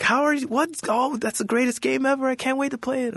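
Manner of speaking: high gushing fan voice; excited fan. Retold or imitated. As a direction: gush like a thrilled fan. Fans gushing, retold.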